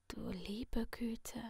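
sinister masked figure in a soft whisper; menacing whisper from behind a mask; creepy whisper. Acted. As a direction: purr the threat like a friendly killer. Letty whispers.

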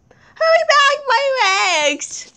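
pained wail. Pretend. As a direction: wail for help, badly hurt in play. Hurt Frankie begs for help.

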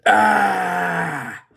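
loud high cry of burning pain. Real, not acted. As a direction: cry out as the mouth burns. Pain from spicy food.

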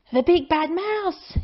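high scared yell; frightened yell. A narrator's reading. Child fleeing the mouse's shadow, frightened yell. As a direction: cry out in fright. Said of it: "yelled the Gruffalo's child".

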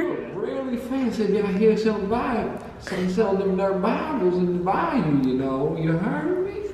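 put-on high, sassy southern accent. Bayou local sneering at Bible sellers, southern accent. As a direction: scold in a hammed-up southern accent. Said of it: "I love that accent".

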